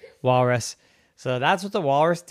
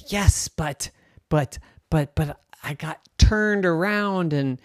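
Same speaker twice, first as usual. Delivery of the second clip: put-on stammering girl; 5 semitones higher than usual.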